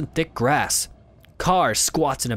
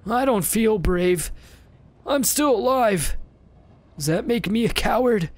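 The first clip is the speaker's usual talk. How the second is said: put-on sad old beggar; 7 semitones higher than usual.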